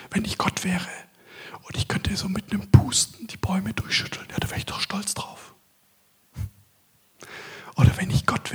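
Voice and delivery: intimate unvoiced whisper, close to the mic; hushed sermon whisper throughout; whispered preaching. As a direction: whisper it as if God is right beside you. Long whispered sermon section on God whispering.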